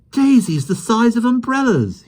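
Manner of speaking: put-on high, loud amazed boy; gasping in wonder. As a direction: blurt it out in wonder. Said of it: "he gasped".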